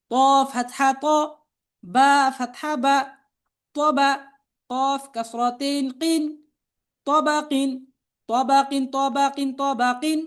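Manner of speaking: level, measured chant with drawn-out letter names; spelling drill recitation. Shown as a demonstration. Teacher spelling out Arabic words for learners to repeat, chanted drill.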